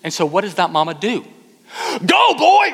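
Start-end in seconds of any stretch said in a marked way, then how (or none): 2.1-2.7 high, loud sideline holler; yelling mom. Acted. Mom to her son who is not moving, yelled. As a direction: yell it like a fed-up soccer mom. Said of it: "She yells".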